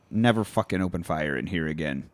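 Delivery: low, soft mock warning; retold quiet threat. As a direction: play a general's hushed threat. Voicing a general threatening his lackey.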